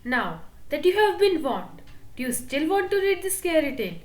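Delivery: ordinary delivery, nothing marked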